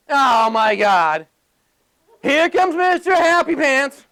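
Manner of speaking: slow, drawn-out mock dismay, rising high; sarcastic mockery. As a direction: imitate a coworker sneering at the cheerful guy. Imitates coworker mocking his cheer.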